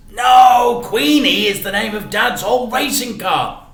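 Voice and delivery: put-on high, loud, eager boy's protest; boy correcting the shopkeeper; excited correction. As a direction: blurt it out, keen to correct. Boy correcting Raj loudly.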